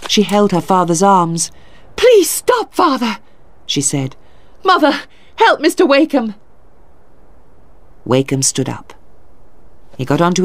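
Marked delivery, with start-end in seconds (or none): 2.0-3.2 high, urgent plea; desperate pleading. A narrator's reading. Daughter holds her father's arms, pleading desperately. Maggie begs father to stop. 4.6-6.3 high, anxious call; urgent appeal. A narrator's reading. Daughter sends mother to the injured man, urgent.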